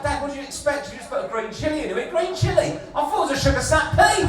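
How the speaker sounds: high, loud, quick protest; incredulous retort. Acted. Comic arguing with Dad over a green chilli, incredulous. Acted exchange with stressed dad.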